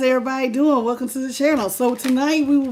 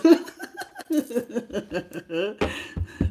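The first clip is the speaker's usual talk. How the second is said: trailing chuckle; long breathy laugh; 4 dB quieter than usual. Real, not acted. Laughing with viewers about their sick voices, long soft laugh. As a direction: laugh it out softly.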